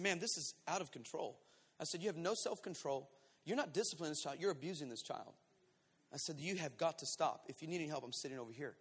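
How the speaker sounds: low, soft, controlled reprimand; sobered, quiet firmness. Retold or imitated. Pastor confronting abusive mother, quietly firm. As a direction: drop right down and stay firm. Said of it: "I sobered out of my anger".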